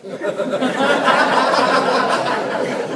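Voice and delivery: real burst of laughter. Real, not acted. Laughs at own story.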